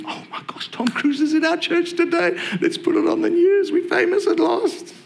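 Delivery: breathless start leaping into very high squeal; hammed-up falsetto fan gushing; mock starstruck excitement. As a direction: act thrilled in a silly, very high voice. Imagined congregation thrilled by celebrity.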